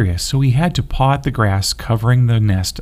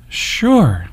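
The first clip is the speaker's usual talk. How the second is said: eager; 6 semitones higher than usual. A narrator's reading. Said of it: "exclaimed in unison".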